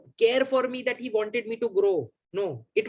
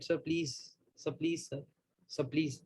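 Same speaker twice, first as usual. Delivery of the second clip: much softer pleading murmur to the team leader; under his breath; 3 semitones lower, 10 dB quieter, slower than usual. Retold or imitated. Nudging the team leader on the call, soft pleading under breath. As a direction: beg quietly so only the boss hears. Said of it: "in my breath".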